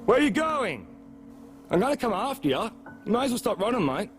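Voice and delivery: high, far-carrying shout; shouting after him. Real, not acted. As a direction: yell after someone running off. Shouting after fleeing man.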